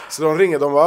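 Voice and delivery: normal talking voice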